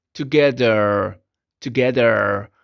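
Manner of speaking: "together" drawn out slowly as tuh-GEH-der; slow "together", the h left silent; slow model pronunciation. Shown as a demonstration. Teacher repeating "together" slowly for students. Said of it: "pronunciamos como together".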